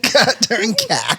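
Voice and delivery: slow, laughing "Goddamn car" with a high drawl; mock Georgia accent. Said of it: "should talk like that".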